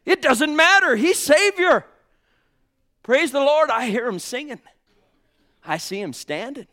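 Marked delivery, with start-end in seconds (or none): 0.1-4.6 full-force preaching. Acted. Preacher crying out to brothers and sisters, full force. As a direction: preach it hard to the congregation.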